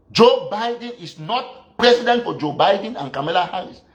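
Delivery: ordinary talk, nothing marked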